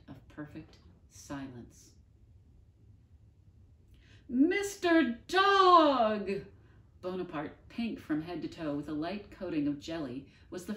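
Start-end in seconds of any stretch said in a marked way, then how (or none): 4.3-6.4 Bonaparte's drawn-out, high, loud wail for his dog; anguished cry. Acted. Bonaparte calling desperately for Mister Dog, a high drawn-out wail. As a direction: wail the name long and high.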